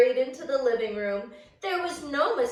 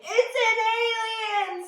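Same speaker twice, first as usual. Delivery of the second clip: higher, laughing little bear; little bear seeing Goldilocks, higher and laughing; 10 semitones higher than usual. Acted. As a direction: giggle out the alien guess. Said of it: "said Little Wee Bear".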